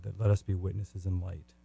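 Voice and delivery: normal talking voice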